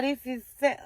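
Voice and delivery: normal talking voice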